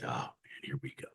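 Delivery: a low, quiet aside of resignation; quiet, sinking reaction; muttered dismay. Retold or imitated. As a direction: say it softly to yourself, resigned. Dismayed reaction to his divorce.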